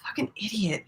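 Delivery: breathy whispered curse; whispered insult. Real, not acted. Whispering an insult at the character on screen. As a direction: hiss it under your breath.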